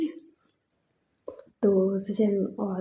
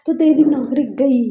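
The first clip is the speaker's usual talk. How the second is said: raised, louder threat to the assistant; threatening shout; 4 semitones higher, 6 dB louder than usual. Retold or imitated. Boss threatening his assistant on the phone, loud threat. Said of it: "हमारे भैया call करते हैं और कहते कि".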